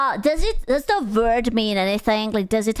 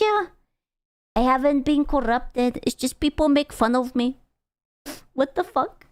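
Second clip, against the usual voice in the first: ordinary talk, nothing marked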